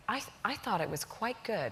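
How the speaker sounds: soft, understated English faint praise; passive-aggressive politeness. Retold or imitated. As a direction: imitate a reserved Brit damning with faint praise. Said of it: "hilariously passive-aggressive way they handle polite compliments".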